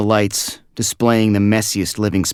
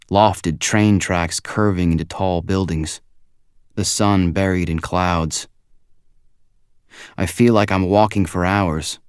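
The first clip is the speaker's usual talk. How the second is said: usual speaking voice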